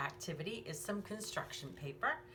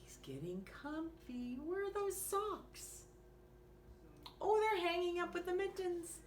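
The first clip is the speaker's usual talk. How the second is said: put-on lively bedtime story read to a small child; reading to children; 3 semitones higher, 7 dB quieter than usual. Reading a bedtime story to young listeners, lively and gentle. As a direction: read it like a bedtime picture book. Reads picture book aloud.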